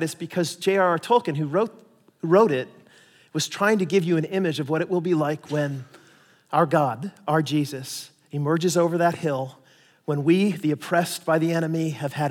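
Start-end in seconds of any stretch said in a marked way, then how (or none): none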